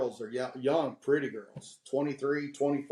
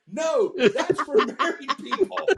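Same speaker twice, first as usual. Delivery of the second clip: high, louder mock protest with a laugh; alarmed correction; 10 semitones higher, 5 dB louder than usual. Speaker correcting young fans, high laughing protest.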